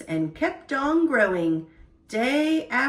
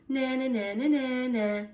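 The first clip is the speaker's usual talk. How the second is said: taunting; 3 dB quieter than usual. Acted. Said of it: "taking to taunting the squirrels".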